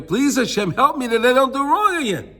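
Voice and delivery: imploring, acted-out prayer; earnest pleading. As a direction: beg for help, pitch climbing. Acted plea to Hashem for help.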